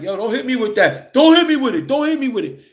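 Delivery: hammed-up scared kid; mock scared pleading. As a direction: beg not to be hit, voice climbing. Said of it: "he's got it at me and I'm scared".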